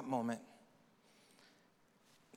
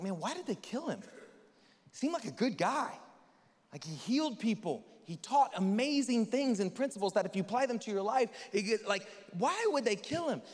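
higher, wondering mock puzzlement; puzzled churchgoer; 6 semitones higher than usual. Believers wondering about Jesus, higher and puzzled. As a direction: play the naive question. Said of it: "we're like".

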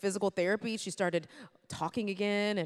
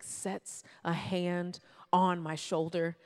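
usual speaking voice

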